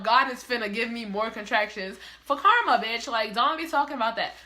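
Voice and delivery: usual speaking voice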